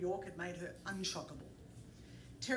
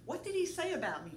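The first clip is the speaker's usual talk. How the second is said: higher, lightly feminine question; a hint of Pauline's voice; 9 semitones higher than usual. Narrator voicing Pauline asking what Dave said, lightly feminine. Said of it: "trying to impersonate a woman".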